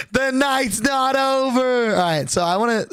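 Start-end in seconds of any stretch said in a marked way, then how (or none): 0.1-2.0 high, slow, drawn-out mock villain line; slow dramatic catchphrase. Friend taunting as he drives off, high and slow. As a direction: stretch it out like a taunt. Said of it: "if you've seen people been saying online".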